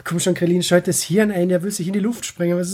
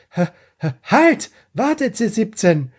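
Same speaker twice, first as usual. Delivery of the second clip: mock shout; 3 semitones higher, 4 dB louder, slower than usual. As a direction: yell it after him to stop. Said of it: "wo der Krillin noch zuschreit". (höher, lauter Ruf: halt, warte)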